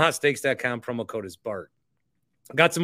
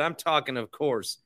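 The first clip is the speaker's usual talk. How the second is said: normal talking voice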